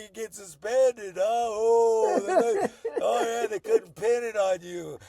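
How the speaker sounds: high, loud mocking classmates; excited teasing. Classmates teasing him on his return to class, loud mocking. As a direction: ham up the jeering classmates.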